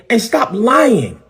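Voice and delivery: high, drawn-out accusation; indignant demand. Real, not acted. Pastor calling out a rival's lies, high indignant demand. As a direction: pitch it up high and stretch "lying".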